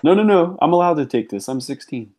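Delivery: high-pitched playful protest; mock teenage protest. Acts teen caught taking Gundam.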